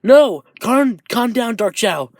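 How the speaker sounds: high, urgent calming plea; alarmed plea. Acted. Tokoyami tries to restrain growing Dark Shadow, alarmed plea. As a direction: start loud, beg for calm. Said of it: "as Tokoyami says".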